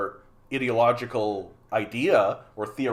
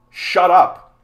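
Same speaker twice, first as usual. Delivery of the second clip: loud, higher-pitched outburst; real fed-up shout; angry command; 4 semitones higher, 10 dB louder than usual. Real, not acted. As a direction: cut them off forcefully. Telling off the commenter.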